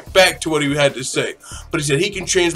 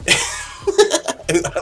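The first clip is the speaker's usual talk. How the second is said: breathy high laugh at his own bit; real laugh; 7 semitones higher than usual. Real, not acted. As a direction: laugh out at your own joke.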